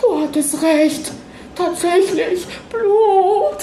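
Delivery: put-on high, slow, shaken old woman's voice; slowly, in dawning horror; horrified friend. A narrator's reading. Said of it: "flüsterte auch sie". (hohe, langsame, entsetzte Frauenstimme)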